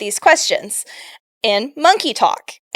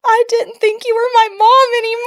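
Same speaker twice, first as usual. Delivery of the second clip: high, small tearful voice; much higher voice of a daughter trying not to cry; teary child; 10 semitones higher than usual. Retold or imitated. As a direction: say it like a kid holding back tears. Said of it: "she had tears in her eyes".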